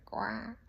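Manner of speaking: duck quack. Retold or imitated. Imitating a duck sound.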